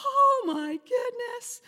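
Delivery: high, slow drawn-out gasp; stunned relief. Retold or imitated. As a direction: breathe it out in disbelief. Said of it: "we're like".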